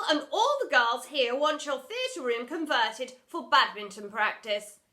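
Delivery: loud, lofty explanation; loud lecturing. Acted. Headmistress lecturing the drama teacher, loud. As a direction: lecture her firmly.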